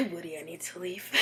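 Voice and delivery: frightened hushed whisper. Retold or imitated. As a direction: whisper it, scared stiff. Retold scared plea.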